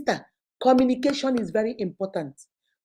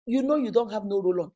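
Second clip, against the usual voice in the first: normal talking voice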